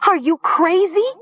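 very high, frantic shriek. A narrator's reading. Nessa grabs Talia's arm, shrieking at her. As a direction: shriek it in disbelief. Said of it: "Nessa shrieked".

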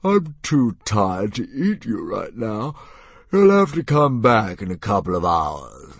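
slow, drowsy dragon voice; a monster nodding off; sleepy dragon. Acted. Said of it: "And the dragon yawned again".

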